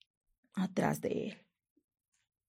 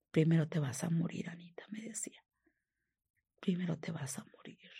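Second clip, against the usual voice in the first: retold hushed threat; 8 dB quieter than usual. Man threatening the woman who wants to leave, hushed. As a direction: quote the threat softly, almost under the breath.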